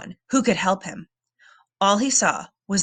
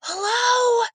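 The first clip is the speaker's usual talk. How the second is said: scared call; 13 semitones higher than usual. A narrator's reading. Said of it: "Sam whispered".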